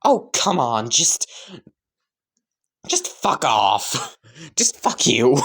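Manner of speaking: slow, fed-up curse breaking into laughs; exasperated swearing. Acted. Mother tells her son off, exasperated and laughing. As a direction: brush him off, tired of him. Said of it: "and say".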